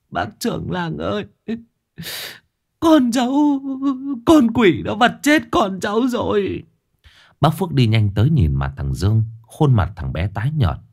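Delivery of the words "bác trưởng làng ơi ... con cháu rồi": very high, shrill wailing woman's voice; a mother screaming that a demon killed her child. A narrator's reading. Mother wailing to the village chief, voice very high and howling. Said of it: "Mẹ thằng Dương rú lên".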